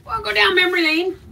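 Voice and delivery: high, giggly; laughing it off. Real, not acted. Finding old photos in the drawer, laughing high.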